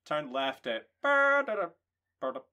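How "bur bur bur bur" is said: long, loud tooted horn notes; mock trumpet call that stands in for a place name; trumpet imitation. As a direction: draw out the name like a trumpet fanfare. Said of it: "the sound of a trumpet".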